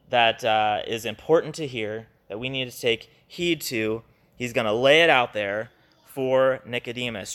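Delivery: ordinary delivery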